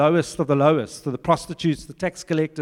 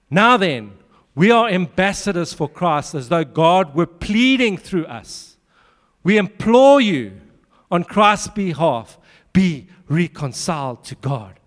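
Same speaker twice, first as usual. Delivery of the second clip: louder, slow, pressing the plea; urgent scripture reading; 5 dB louder, slower than usual. Retold or imitated. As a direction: read it like an urgent appeal. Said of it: "There's an urgency".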